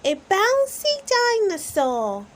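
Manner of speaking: high, loud correcting shout; high excited little-girl shout; Peppa shouting. A narrator's reading. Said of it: "shouts Peppa".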